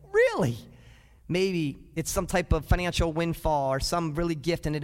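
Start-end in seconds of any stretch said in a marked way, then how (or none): 0.1-0.6 amazed disbelief. Retold or imitated. Preacher miming a taxpayer seeing the refund check, high incredulous. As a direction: leap up in pitch like you can't believe the check. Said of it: "You're like".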